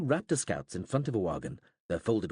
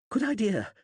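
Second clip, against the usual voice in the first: gasped; 12 semitones higher, 5 dB louder than usual. A narrator's reading. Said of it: "he gasped".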